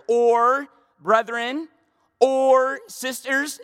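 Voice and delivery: each "or" drawn out slow and loud; stress on each or. Shown as a demonstration. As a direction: lean hard on every "or". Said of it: "I'm emphasizing it 'cause he would have emphasized it".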